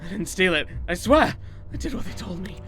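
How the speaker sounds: loud, panicked denial; desperate pleading. Acted. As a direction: beg them to believe you. Accused man pleads desperately.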